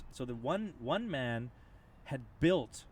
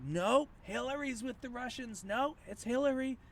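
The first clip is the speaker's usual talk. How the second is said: stubborn denier; 12 semitones higher than usual. Retold or imitated. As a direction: play the woman who refuses to believe it, voice pushed high. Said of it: "She's like".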